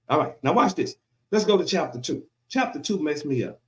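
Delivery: ordinary talk, nothing marked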